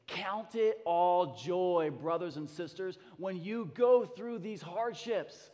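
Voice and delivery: slow, weighty reading of the verse; scripture reading. Acted. Pastor reading James to the church, slow and weighty. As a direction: read it out like a proclamation.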